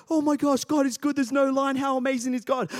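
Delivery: delighted outburst, lightly acted; excited. Pastor reenacting his joy at an empty queue, mock-thrilled. Dad seeing no queue at ride.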